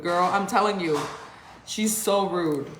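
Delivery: normal talking voice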